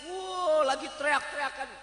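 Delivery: usual speaking voice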